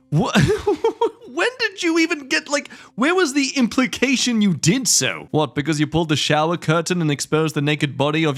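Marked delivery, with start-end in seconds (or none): 0.4-1.1 high burst of disbelieving laughter; incredulous laugh. Real, not acted. Mocking the commenters with an incredulous high laugh. 1.3-5.3 incredulous. Real, not acted. Mocking commenters' accusation.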